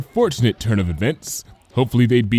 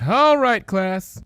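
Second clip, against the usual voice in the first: resigned sigh; 11 semitones higher, much slower than usual. Acted. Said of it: "said in resignation".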